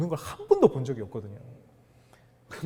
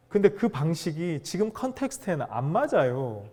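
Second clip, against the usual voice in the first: ordinary delivery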